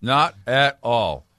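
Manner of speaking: slow, firm, word-by-word "Not at all"; emphatic denial. Real, not acted. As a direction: punch each word of "Not at all", drawn out. Emphatic comeback in playful argument.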